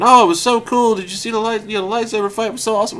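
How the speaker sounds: high-pitched imitation of excited friends; mock fan gushing. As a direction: gush like thrilled moviegoers, high voice. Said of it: "they came out saying".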